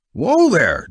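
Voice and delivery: loud, high warning call; yelling. A narrator's reading. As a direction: shout to stop a shot. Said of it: "Jack yelled".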